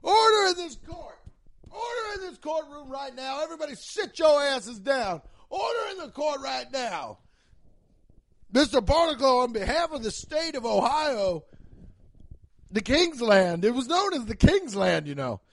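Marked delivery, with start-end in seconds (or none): 0.0-7.2 high, loud frantic judge yelling; judge shouting order. Pretend. 8.5-15.1 louder, pompous judge. Pretend. Judge pronounces verdict.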